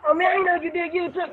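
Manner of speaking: high, fake-surprised fan; mock fan voice. Imitating fans at him.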